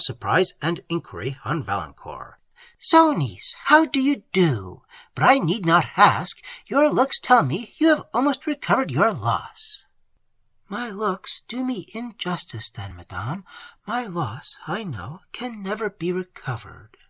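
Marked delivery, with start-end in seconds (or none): 2.8-9.8 higher, pointed and condescending; haughty aunt. A narrator's reading. Aunt greeting her grieving niece, higher and condescending. Narrator voices Madame Cheron. 10.7-17.0 softer, restrained and dignified; grieving young woman. A narrator's reading. Emily answering her aunt, soft and dignified.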